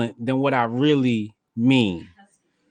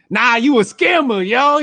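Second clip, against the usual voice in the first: high, louder mock heckle; lightly voiced heckler, much higher; 11 semitones higher, 5 dB louder than usual. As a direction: call her out like a heckler. Retold Lucas heckling Candace.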